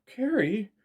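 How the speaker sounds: high, loud cry of his name; terrified cry. A narrator's reading. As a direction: call out in terror. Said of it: "full of terror and confusion".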